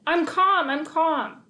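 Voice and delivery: loud, high insistent shout; mock-calm shout. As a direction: yell that you're calm. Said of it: "thought calm thoughts and shouted".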